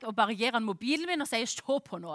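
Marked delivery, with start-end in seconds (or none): 1.5-2.1 low, soft, half-asleep mumble; resigned early-morning murmur; worn-out parent giving in. Retold or imitated. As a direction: mumble it low as if barely awake. Worn-out parent hands over phone.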